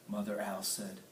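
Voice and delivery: plain reading voice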